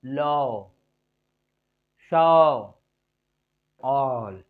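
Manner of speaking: "लॉ, शॉ, ऑल" long, with some air let out; held open "लॉ, शॉ, ऑल"; airy open vowel. Shown as a demonstration. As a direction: stretch the vowel in "लॉ, शॉ, ऑल", releasing air. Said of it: "हवा छूटता है".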